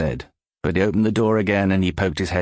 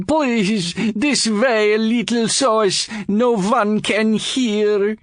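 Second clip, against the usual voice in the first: put-on much higher, slow, accented coaxing; furtive plea; 13 semitones higher, 3 dB louder, slower than usual. George draws Pantaloon away, high and coaxing. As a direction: lure him aside politely.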